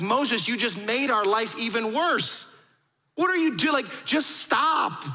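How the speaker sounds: imitating fed-up people, slow and high; exasperated complaint. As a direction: plead in exasperation, pitch climbing. People complain to Moses, acted out.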